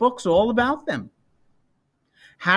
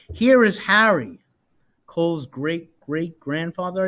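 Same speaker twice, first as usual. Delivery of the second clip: plain reading voice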